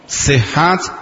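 usual speaking voice

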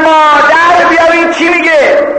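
high, sustained acted scream; screaming for mother. Girl summons her mother against the intruder, high shout. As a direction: cry out for mother at a high, held pitch. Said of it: "داد زد".

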